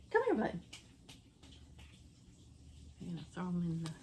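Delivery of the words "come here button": high sing-song call to the dog; calling a pet. Real, not acted. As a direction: call the dog sweetly, pitched up.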